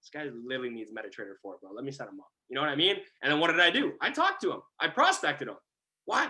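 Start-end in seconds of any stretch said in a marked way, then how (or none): 0.0-2.3 low private thought; under the breath to himself; muttered aside. Retold or imitated. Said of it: "I'm like".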